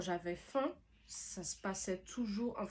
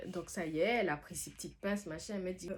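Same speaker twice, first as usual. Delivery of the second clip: ordinary talk, nothing marked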